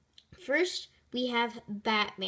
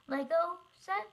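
normal talking voice

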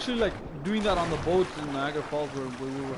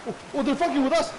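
higher, louder startled yell; excited yell; 10 semitones higher, 5 dB louder than usual. Real, not acted. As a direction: shout as the enemies attack. Attacked in game.

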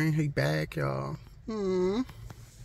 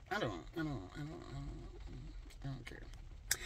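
drops to a near whisper, much softer; whispered dismissal; 5 semitones lower, 19 dB quieter than usual. Real, not acted. Shrugging off whether things change, barely whispered. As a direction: let it fade to an unvoiced whisper.

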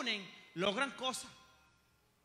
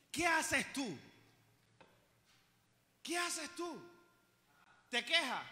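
ordinary talk

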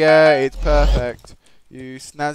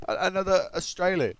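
normal talking voice

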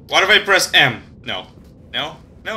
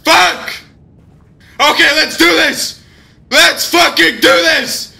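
loud psyched-up shouting; scared hype; 9 semitones higher, 4 dB louder than usual. Real, not acted. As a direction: pump yourself up against fear. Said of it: "this shit is fucking scary".

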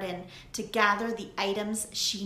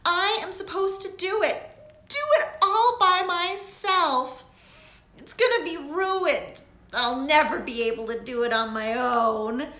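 much higher and louder, desperate; frantic; 8 semitones higher, 8 dB louder than usual. A narrator's reading. Lily to Daisy, frantic and near tears. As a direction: panic about the ruined night. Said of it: "You are frantic".

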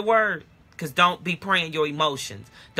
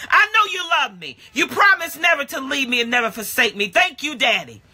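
full-force preaching; 5 semitones higher than usual. Acted. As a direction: preach it hard to the church.

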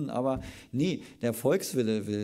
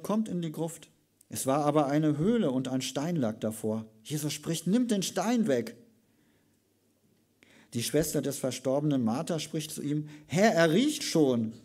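raised, higher scripture reading with the quotes acted out; loud, higher Bible reading; dramatic scripture reading; 4 semitones higher, 5 dB louder than usual. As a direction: proclaim the Bible passage to the congregation. Preacher reads scripture aloud.

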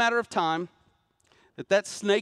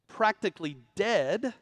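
ordinary talk, nothing marked

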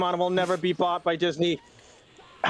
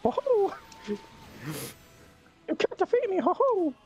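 mock villain; slower than usual. Mock villain laugh and taunt.

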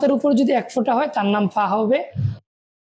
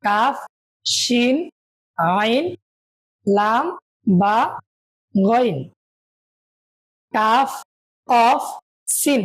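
reciting letters; much slower than usual. Shown as a demonstration. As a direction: clear letter drill. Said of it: "আমার সাথে বলুন". (ছাত্রদের জন্য হরফ পাঠ)

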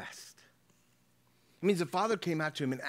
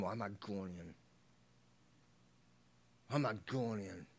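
sullen refusal; 4 semitones lower, 5 dB quieter than usual. Acted. Acting the sulking older brother.

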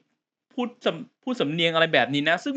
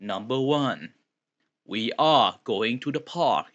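very slow, stilted textbook English announcer; English listening test voice; 3 semitones lower, much slower than usual. Retold or imitated. Thai reviewer mocking stiff exam-tape English, very slow textbook reading. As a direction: read it like an exam tape narrator. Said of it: "พวกฝรั่งที่เราได้ยินเวลาเราสอบอะ ที่มันมี listening test".

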